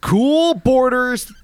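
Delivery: very slow, high Cool Borders, stretched like an announcer; drawn-out title. Shown as a demonstration. Hyping the game for the co-host, stretched title.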